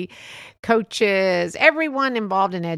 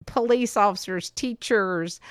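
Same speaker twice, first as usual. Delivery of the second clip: normal talking voice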